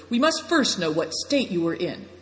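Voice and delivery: ordinary delivery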